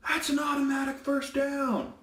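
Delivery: high mocking coach cry; a coach yelling at the ref, impersonated high; mock screaming coach. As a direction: squeal it like a coach losing it. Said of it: "a coach starts screaming".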